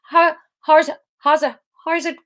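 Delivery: high, halting stab at Irish; attempted Irish accent. Shown as a demonstration. Reader searching for Theo's voice, halting Irish attempt. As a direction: keep restarting, hunting for the Irish accent. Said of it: "Um, Irish, yes".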